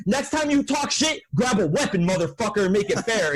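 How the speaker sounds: high, heated challenge, easing a little; retold angry threat. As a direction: re-enact squaring up for a fight. Retold fight, threatening Jason.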